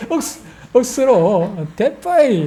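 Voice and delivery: playful Gyeongsang dialect, 억수로 drawn out slow; lightly hammed Gyeongsang 억수로 then 대빠이 jumping high; 경상도 사투리로 억수로, 대빠이. Shown as a demonstration. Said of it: "경상도 말로 하면은".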